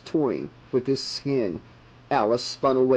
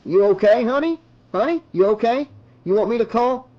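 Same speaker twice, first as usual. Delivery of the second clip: high, raised voice of concern; worried customer calling across the counter; 8 semitones higher, 4 dB louder, faster than usual. A narrator's reading. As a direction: call over to someone in trouble, questions rising.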